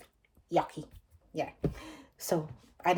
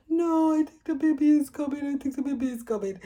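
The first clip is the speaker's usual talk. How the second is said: high, louder, pleading; urgent plea; 9 semitones higher, 5 dB louder than usual. Retold or imitated. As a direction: insist the baby is coming.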